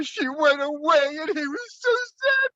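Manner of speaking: hammed-up sorrow climbing to a high squeal; mock wailing sadness. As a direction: narrate it as if breaking into comic sobs. Acting out the sad parting.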